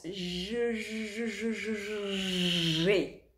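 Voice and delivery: put-on soft, stuttering child voice; childish sound game. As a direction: play a kid toying with syllables. Kids play with sounds.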